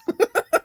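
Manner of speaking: sudden shrieking laugh; high loud laugh. Real, not acted. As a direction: burst into a high cackle. Laugh then chant.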